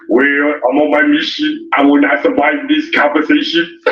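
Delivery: lightly put-on low action-hero voice; a hint of the action hero, pitched low; Arnold Schwarzenegger. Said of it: "in my Arnold Schwarzenegger voice".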